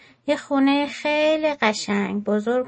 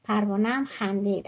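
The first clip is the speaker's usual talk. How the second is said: usual speaking voice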